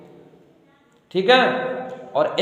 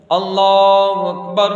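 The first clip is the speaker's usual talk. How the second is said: drawn-out chanted Allahu Akbar, louder; takbir call; 4 dB louder, much slower than usual. Shown as a demonstration. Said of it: "इमाम साहब अल्लाह अकबर बोलेंगे". (इमाम की तरह तकबीर पुकारना)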